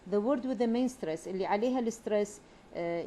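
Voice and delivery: ordinary talk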